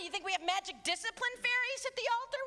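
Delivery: ordinary talk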